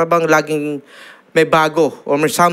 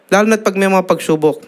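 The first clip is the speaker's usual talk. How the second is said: usual speaking voice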